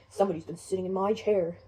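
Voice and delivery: deep, loud big bear voice; Papa Bear. Acted. Father bear seeing his chair was used, deep and loud. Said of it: "Papa Bear comes over and says".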